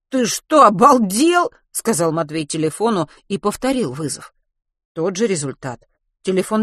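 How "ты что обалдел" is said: loud, high-pitched annoyed question; indignant outburst. A narrator's reading. Boy scolding his phone that will not call, indignant. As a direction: tell off the useless gadget, raised voice.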